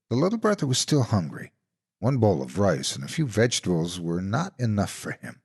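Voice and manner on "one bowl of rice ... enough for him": ordinary delivery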